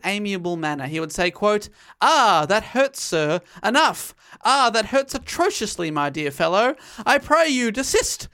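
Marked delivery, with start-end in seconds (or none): 2.0-6.8 mock-posh British gent, higher and louder, theatrically pained; hammed-up upper-class Englishman whining about being shot; phony British accent. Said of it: "he would say under his breath with a phony British accent". 7.1-8.3 higher, mock-posh plea, stretched out; a hammed-up English gentleman begging politely; phony British accent. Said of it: "with a phony British accent".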